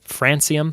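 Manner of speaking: "Francium" as FRAN-see-um; soft-c pronunciation. Shown as a demonstration. Quiz host testing ways to say an element, soft-c version. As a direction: say "Francium" with an s sound and flat a as in ran. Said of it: "I don't know how to say it".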